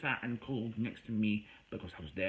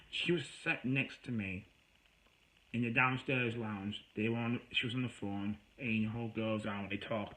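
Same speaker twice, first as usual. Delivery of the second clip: usual speaking voice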